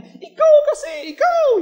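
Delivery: high, loud shrieking blame; a laboring wife shrieks at her husband; wife in labor screaming. Retold or imitated. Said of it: "sumisigaw, sabi niya".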